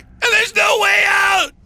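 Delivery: high, loud panicked shout; desperate shout. A narrator's reading. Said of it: "one of them shouted".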